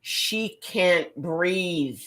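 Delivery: very slow, deliberate explanation; slow realization. Retold or imitated. Charge nurse explaining to new nurse, very slow. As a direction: spell it out slowly for the confused nurse. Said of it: "she goes".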